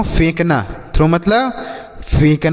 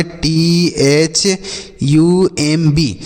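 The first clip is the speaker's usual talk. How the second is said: very slow spelling; much slower than usual. Shown as a demonstration. Teacher slowly spells T H U M B to show the silent B. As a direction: drag each letter out slowly. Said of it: "B लिखा गया है ना, यह silent है".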